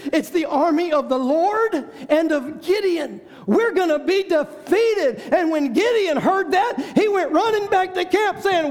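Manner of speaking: rising to full preaching force; climactic preaching. Acted. Preacher building the congregation to Gideon's victory cry.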